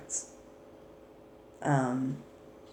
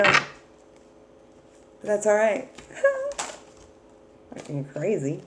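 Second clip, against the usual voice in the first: ordinary delivery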